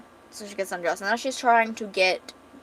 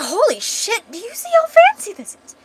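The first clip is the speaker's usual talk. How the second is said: very high, louder acted amazement; amazed exclamation; 13 semitones higher, 8 dB louder than usual. Guest seeing how fancy the room is, amazed. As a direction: squeal it in hammed-up awe.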